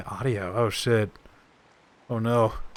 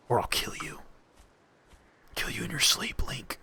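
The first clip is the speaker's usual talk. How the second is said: unvoiced threatening whisper; menacing whisper; 7 dB quieter than usual. Game character threatens Link, whispering. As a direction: breathe the threat right into his ear.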